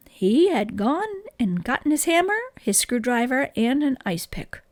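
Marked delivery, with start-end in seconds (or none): none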